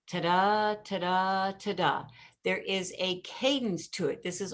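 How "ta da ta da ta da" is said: Ta-da tapped out with weak then strong beat, da stressed; iambic beat. Shown as a demonstration. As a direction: chant "Ta-da" to show the da-DUM rhythm. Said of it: "it's got what's called iambic meter".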